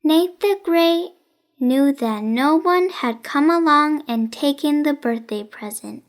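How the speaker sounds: usual speaking voice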